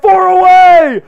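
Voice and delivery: loud, high, slow shout; excited shout. Real, not acted. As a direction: hype the last seconds. Final countdown to goal.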